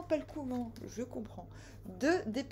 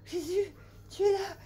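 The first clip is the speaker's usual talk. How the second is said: hammed-up breathless relief; out of breath, amazed; 9 semitones higher, 4 dB louder than usual. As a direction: gasp it out after the climb. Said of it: "il est essoufflé".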